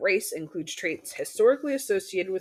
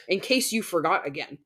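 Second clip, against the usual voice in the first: ordinary talk, nothing marked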